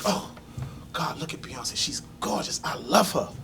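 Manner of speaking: soft, swooning murmur; nearly whispered awe; hushed adoration. Retold or imitated. Adoring [name] on TV beside girlfriend.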